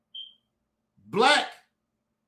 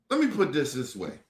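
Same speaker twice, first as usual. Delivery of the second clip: ordinary talk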